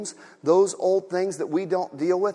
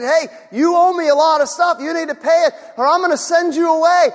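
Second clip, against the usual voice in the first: loud, high demand for payment; a master calling in the debt, loud and high; stern master; 11 semitones higher, 11 dB louder than usual. Acted. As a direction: bark it at the debtor.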